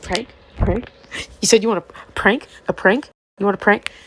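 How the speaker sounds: loud, disbelieving repeated question; mock outraged friend; incredulous shout. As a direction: yell it back at him in disbelief.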